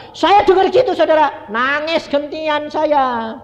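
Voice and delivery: high, loud, impassioned outburst; worked-up retelling. Retold or imitated. Preacher telling listeners that he wept, high and worked-up. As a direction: pitch it high and push the volume.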